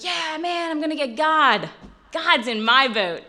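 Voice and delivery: a hint of a pumped-up teammate; eager buddy voice. Friend voiced in boat story.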